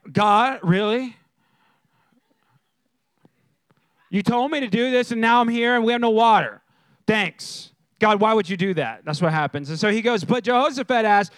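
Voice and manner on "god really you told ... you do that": mildly exasperated complaint to God; mock mad prayer. Preacher imitates someone praying when things go wrong, mock mad. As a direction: act out a put-out believer, a touch loud. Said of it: "get mad".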